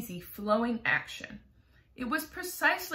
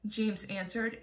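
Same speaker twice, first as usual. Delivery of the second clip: ordinary delivery, nothing marked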